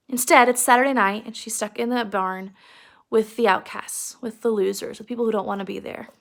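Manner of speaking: usual speaking voice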